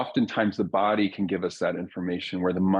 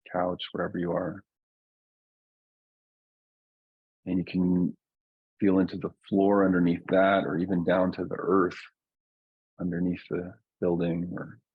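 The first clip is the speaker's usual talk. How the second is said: guided meditation. Real, not acted. Teacher settling a group into their bodies, calm guided meditation. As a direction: ease listeners down gently, leaving long silences. Leads a slowing-down grounding meditation.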